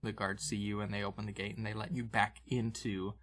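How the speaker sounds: normal talking voice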